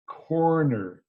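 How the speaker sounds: corrected corner with full R's; both R's in corner. Shown as a demonstration. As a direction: carefully pronounce each R in corner. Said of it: "The two R's".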